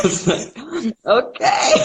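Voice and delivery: high, giggly reply; laughing while talking. Real, not acted. Actor retelling reply to agent's criticism, laughing. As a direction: squeal it out through laughter.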